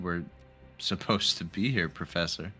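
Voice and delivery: ordinary delivery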